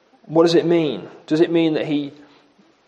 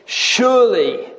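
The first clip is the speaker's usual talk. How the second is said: "Surely" drawn out slowly on a higher, falling pitch; emphatic surely; 5 semitones higher, slower than usual. Acted. Preacher quoting Jacob waking at Bethel, emphatic on "Surely". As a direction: lift "Surely" and let it fall with conviction. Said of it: "This is the emphasis that Jacob".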